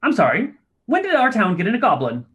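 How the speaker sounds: tiny gnome voice. Acted.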